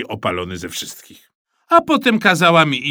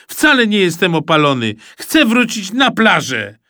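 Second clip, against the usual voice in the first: put-on higher, louder pleading child; upset protest; 6 semitones higher, 4 dB louder than usual. Mikołaj begs his dad to go back, loud and high. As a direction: push the protest up in pitch. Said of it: "krzyknąłem".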